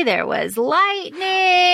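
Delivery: high, slow, dreamy delivery; swooning quote. Acted. Host swooning over the romantic line, high and slow. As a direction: let it melt out slowly.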